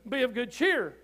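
loud, ringing preacher's proclamation of scripture; full-force preaching. Acted. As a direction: preach it out to the whole church.

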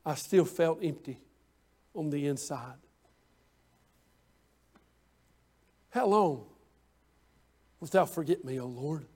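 hushed despair. Acted. Preacher voicing David's empty plea to God, hushed and sinking. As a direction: let the plea drain away into near silence.

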